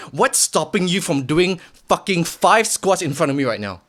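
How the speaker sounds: normal talking voice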